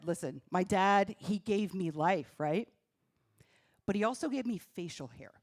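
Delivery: ordinary delivery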